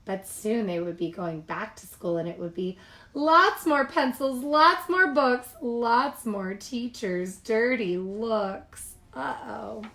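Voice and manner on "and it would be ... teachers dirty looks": louder, then slowing on the last line; ominous echo. A narrator's reading. Narrator twisting the cubs' chant ominously. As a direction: turn the chant around gloomily.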